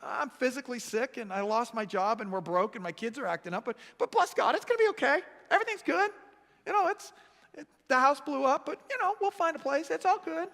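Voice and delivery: chirpy carefree impression, high and breezy; cheery unbothered guy. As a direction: play the guy who shrugs off every disaster. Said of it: "one of those guys ... They're like".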